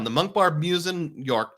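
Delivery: ordinary delivery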